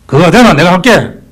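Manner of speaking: loud, high, scornful imitation of a showy son; mocking boast. Preacher mocking sons who spend big on shrouds, loud mock boast. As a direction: sneer it out as the hypocrite.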